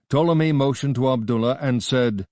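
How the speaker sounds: ordinary delivery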